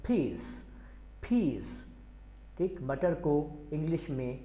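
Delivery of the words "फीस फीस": फीस with a puffed p and z at the end; फीस like "peez" with breath; aspirated p. Shown as a demonstration. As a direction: blast the p of फीस. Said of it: "जी की आवाज़ sound आखिर में देनी है".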